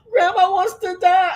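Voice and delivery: high, loud tearful wail imitating a child; mock-cry it like an upset little boy; put-on tearful kid voice. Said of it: "Then my son came along".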